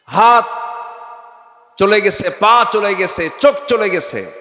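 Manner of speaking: high, loud, drawn-out listing; anguished preaching cry. Real, not acted. Preacher recalls July's maimed protesters, loud anguished cry. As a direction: cry out each loss with force.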